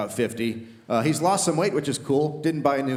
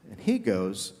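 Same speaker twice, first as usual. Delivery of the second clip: usual speaking voice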